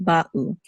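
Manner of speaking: ba'u with a small gap between ba and u; distinct syllables. Shown as a demonstration. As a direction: separate the two syllables of ba'u. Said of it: "They should be distinct".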